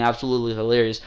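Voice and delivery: ordinary talk, nothing marked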